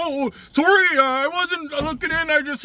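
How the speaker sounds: put-on high, flustered apology from a caught old man; old man caught at the van windows stammers out a flustered apology.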